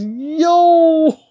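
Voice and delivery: long, high, loud whoop; excited yell. Real, not acted. Streamer shows off new swords, long excited yell. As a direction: holler it out in excitement.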